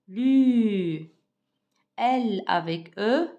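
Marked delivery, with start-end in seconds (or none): 0.1-1.1 "lu" loud, the French U held very long; pronunciation demo. Shown as a demonstration. Teacher joins L with U for pupils, "lu" long and loud. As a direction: stretch out the vowel of "lu". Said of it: "comment je prononce?".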